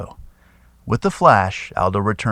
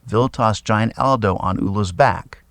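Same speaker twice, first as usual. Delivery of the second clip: ordinary delivery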